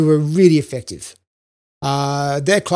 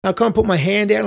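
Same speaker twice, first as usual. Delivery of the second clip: ordinary delivery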